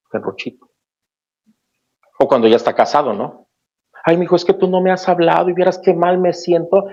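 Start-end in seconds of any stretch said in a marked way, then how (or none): 4.0-6.9 high, whiny mock lament; hammed-up needy mother, pitched way up; guilt-tripping mother. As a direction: lay the guilt on thick like a clingy mother. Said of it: "empieza con una serie de chantajes".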